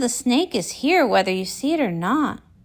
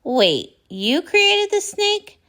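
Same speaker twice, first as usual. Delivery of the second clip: ordinary delivery, nothing marked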